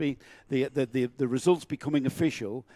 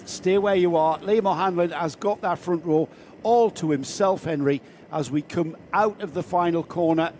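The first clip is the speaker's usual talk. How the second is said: race commentary; 3 semitones higher, 4 dB louder than usual. Acted. Live play-by-play.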